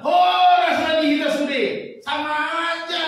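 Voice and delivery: loud, high Batak exclamation; hearty Batak shout, pitched high; Batak style. Retold or imitated. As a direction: boom it out Batak fashion. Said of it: "Kalau di Batak bilang".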